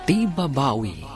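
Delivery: normal talking voice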